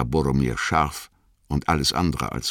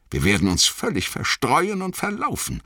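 high, exasperated groan; 13 semitones higher than usual. A narrator's reading. Aragorn despairs as the company scatters, groaning. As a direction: groan it in dismay, pitched high. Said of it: "stöhnte Aragorn".

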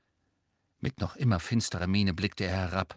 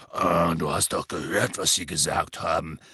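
lower, dismissive rumble; contemptuous; 6 semitones lower than usual. A narrator's reading. Hagrid contemptuously brushing off Hermine's worry, low. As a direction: scoff at it. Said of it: "erwiderte Hagrid verächtlich".

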